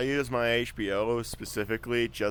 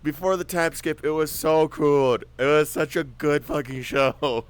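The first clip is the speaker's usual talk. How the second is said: excited; 5 semitones higher, 5 dB louder than usual. Real, not acted. Lamenting show before time skip.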